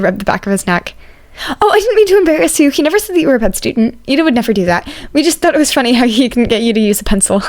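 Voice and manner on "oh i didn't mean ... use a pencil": put-on higher, bright young voice, apologetic; a cheerful student, eager to reassure. Izuku's eager reply.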